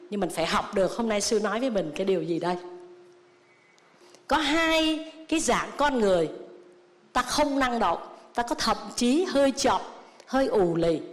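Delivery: ordinary delivery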